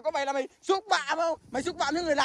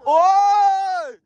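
loud, high, drawn-out cry of "oh"; startled "oh"; 5 semitones higher, 5 dB louder than usual. Real, not acted. Man reacts to the squabbling couple, startled cry. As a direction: let out a long startled yell.

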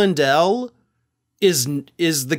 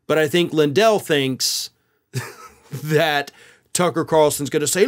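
normal talking voice

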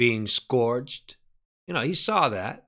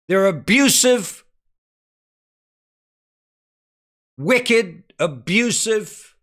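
raised, insistent pulpit delivery; forceful preaching; 9 semitones higher, 5 dB louder than usual. Real, not acted. Preacher on saints rejoicing under persecution, forceful preaching. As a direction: press each phrase on the congregation at high pitch.

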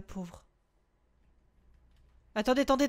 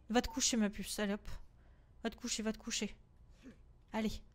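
soft bedtime voice; 3 semitones lower, 8 dB quieter than usual. Pretend. Mom tucking game kid in.

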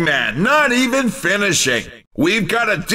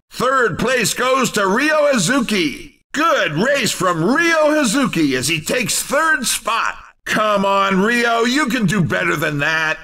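ordinary talk

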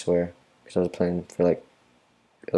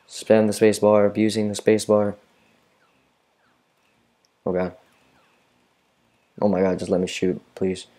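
normal talking voice

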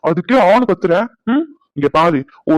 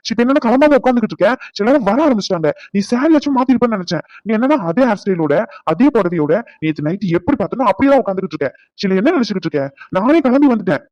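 angry scolding; 3 semitones higher than usual. Acted. Husband scolding wife for not getting ready. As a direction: scold her hard.

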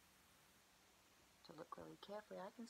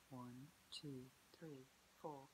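quiet counting; 4 semitones lower, 9 dB quieter, much slower than usual. Acted. Emma counting for hide and seek.

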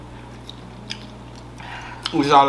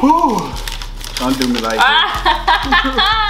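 high, slow laughing complaint; laughing whine; 4 dB louder, much slower than usual. Real, not acted. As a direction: wail it through laughter. Got spicy again.

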